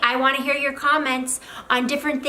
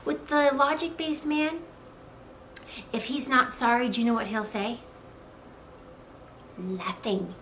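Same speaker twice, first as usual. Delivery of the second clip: normal talking voice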